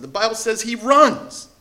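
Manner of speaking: emphatic preacher stress on "runs". Real, not acted. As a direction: lean hard on "runs" with a rising pull. Preacher stressing a surprising detail.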